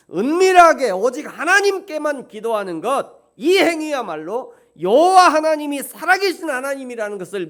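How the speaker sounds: high, loud rising sermon; fervent preaching. Acted. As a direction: build the preaching with fire. Preaching at full force. (힘차게 고조되는 설교)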